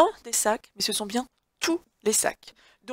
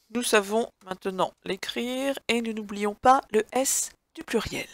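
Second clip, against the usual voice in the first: ordinary talk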